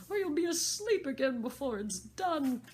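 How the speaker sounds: high, loud bossy Hatter; impatient hatter. Acted. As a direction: hurry them as the Hatter. Hatter voiced in story.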